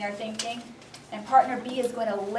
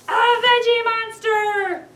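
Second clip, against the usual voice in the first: high, loud, slow roar of the words; monster voice; 11 semitones higher, 9 dB louder, much slower than usual. A narrator's reading. Boy turns into a veggie monster, roaring slowly. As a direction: roar it like a growing beast.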